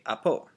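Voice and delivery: apo with a falling second syllable; downward inflection. Shown as a demonstration. Teacher has students say apo, downward inflection. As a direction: say apo, coming down on the end. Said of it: "coming downward like that".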